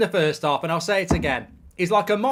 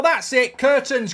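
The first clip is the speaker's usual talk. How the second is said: normal talking voice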